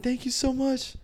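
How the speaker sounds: retold overjoyed cheer; elated exit. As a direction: squeal it walking out. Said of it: "cursing as I'm walking out".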